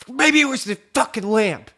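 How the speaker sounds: hammed-up high, loud rage; mad outburst. Housemate blaming a lamp for lost sleep, imitated angry yell. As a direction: mimic him raging at the absurd culprit. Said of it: "He's so fucking mad".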